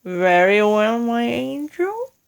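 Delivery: sweeten it with a wicked grin; ghoulish grin. Acted. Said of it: "with a ghoulish grin".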